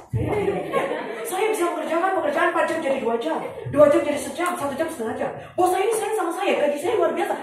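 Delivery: high, proud mock boast with laughter; mimicking the boastful speaker. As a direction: show off like she owns the room. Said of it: "ngomongnya gini".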